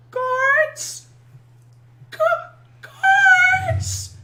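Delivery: put-on very high, loud child calling for help; shrill-pitched little kid crying out for the guards; little kid's voice. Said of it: "in the little kid's voice".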